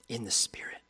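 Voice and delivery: hushed whisper of blessing. Acted. Preacher closing the blessing, whispered.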